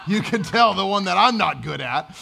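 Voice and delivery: laughing loud at himself. Real, not acted. Preacher laughing at his own parenting, laughing. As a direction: let the laugh break through.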